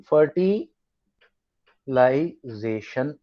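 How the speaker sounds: Fertilisation very slowly, broken into syllables. Shown as a demonstration. Teacher dictating a new term to the class, syllable by syllable. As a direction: draw out fertilisation piece by piece.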